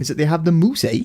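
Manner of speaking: playful Canadian impression tacking on eh; mock Canadian accent. Cohost riffing on Canada for the podcast, light mock Canadian accent. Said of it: "hard, hard accent".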